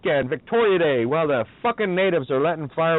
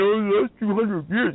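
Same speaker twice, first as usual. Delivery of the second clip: slow, higher mock whine; sarcastic imitation of a complaining native; mock whining native; 5 semitones higher, slower than usual. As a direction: whine it out slowly in a put-on voice.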